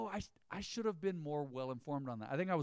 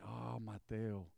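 teasing boss; 5 semitones lower, 7 dB quieter, much slower than usual. Retold or imitated. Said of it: "He called me Mateo".

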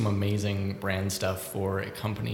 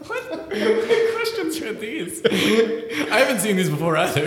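laughing; 12 semitones higher, slower than usual. Real, not acted. Laughing at odd trivia questions.

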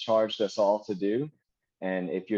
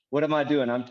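ordinary talk

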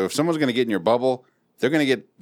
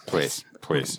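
put-on low quiet old man; 7 semitones lower, 5 dB quieter than usual. Verbal tic old man.

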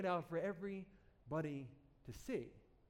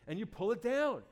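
ordinary talk, nothing marked

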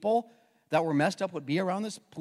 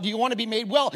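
rushed question; 2 semitones higher, faster than usual. Retold or imitated. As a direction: blurt it before he finishes. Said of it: "Jesus didn't even get the words out".

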